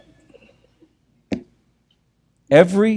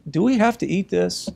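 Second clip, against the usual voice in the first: put-on whining kids; 8 semitones higher than usual.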